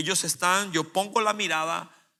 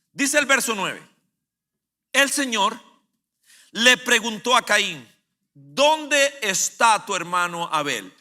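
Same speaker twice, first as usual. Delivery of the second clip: loud, higher pulpit preaching; preaching at full force; 5 semitones higher, 8 dB louder than usual. Acted. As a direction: drive it home to the congregation.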